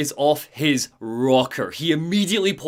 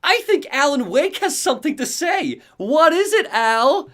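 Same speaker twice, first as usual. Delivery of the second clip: mocking character voice; 9 semitones higher than usual.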